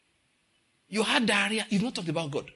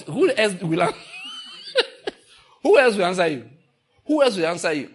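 laughing shout; 3 semitones higher, 6 dB louder than usual. Real, not acted. Preacher laughs mid-rebuke.

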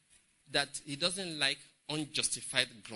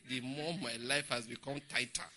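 normal talking voice

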